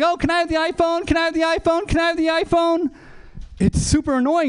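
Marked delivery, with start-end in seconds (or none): 0.0-2.9 put-on high, pleading kid voice; whiny child voice. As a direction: beg like a nagging toddler. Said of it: "it's like".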